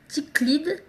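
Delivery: French-accented attempt at English "Ticklid"; unsure English pronunciation of "Ticklid" by a French speaker; bad English accent. Real, not acted. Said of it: "désolée pour mon accent pourri".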